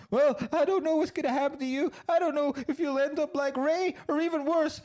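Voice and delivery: high, loud melodramatic fretting; high, pleading mock-sob voice; mock tearful girlfriend. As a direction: ham up the worried lover. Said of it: "She's like that".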